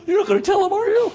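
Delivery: high, whiny mock plea; pleading child. Child begs mother not to tell father, high pleading. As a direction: beg like a scared kid. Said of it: "you went".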